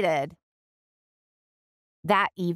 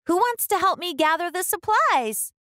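put-on higher, eager little girl asking; hopeful girl's request, pitched up; Ruby's voice; 10 semitones higher than usual. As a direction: ask brightly for a helper. Ruby asks again.

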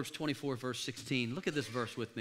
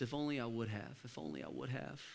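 much softer and lower, trailing off; fading to a hush; 7 semitones lower, 13 dB quieter than usual. Shown as a demonstration. Preacher echoing regret to the crowd, fading to a hush. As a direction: let the regret fade away. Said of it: "fading echo of regret".

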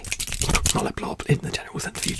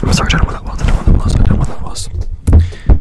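fast aggressive asmr; 10 dB louder than usual. Real, not acted. Repeated trigger phrase, loud.

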